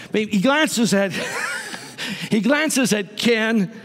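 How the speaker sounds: mock-dramatic callback to Ken; playful emphasis. Shown as a demonstration. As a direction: draw it out with a knowing grin. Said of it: "Well, maybe not Ken".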